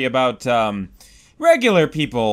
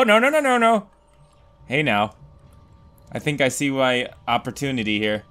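ordinary talk

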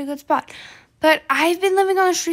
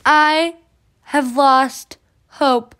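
mock despair; 8 semitones higher, 5 dB louder than usual. Fake despair as poor character.

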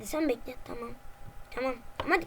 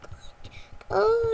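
higher unvoiced whisper; 5 semitones higher than usual. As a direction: breathe it out in fear. Scared whisper about noise.

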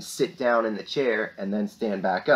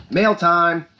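called out louder and higher, like a show segment; excited announcement; 6 semitones higher, 7 dB louder than usual. Real, not acted. Teacher to her class, excited announcement.

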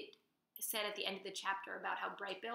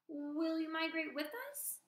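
put-on higher, hopeful child voice; pleading to mama; young gosling; 8 semitones higher than usual. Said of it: "said Bright Bill".

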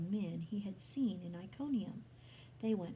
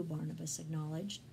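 usual speaking voice

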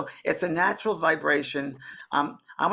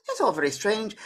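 higher-pitched imitation of a lady; Scottish lady; 7 semitones higher than usual. Bewildered spirit lady reacting to the news, put-on higher voice. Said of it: "a lady from Scotland".

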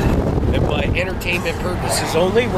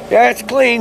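mock answer; 9 semitones higher, 6 dB louder than usual. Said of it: "mock answer".

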